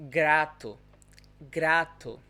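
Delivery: "grato" with a tapped R, loud and clear; vibrating R. Shown as a demonstration. Teacher modelling the final example word, vibrating R. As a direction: flick the R in "grato", firmly projected.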